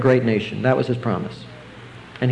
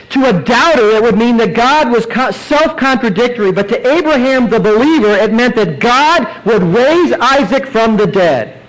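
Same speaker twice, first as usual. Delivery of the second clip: loud, higher preaching, slowing on the last line; fiery preaching; 7 semitones higher, 5 dB louder than usual. Acted. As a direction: drive the point home to the congregation. Preacher builds to climax.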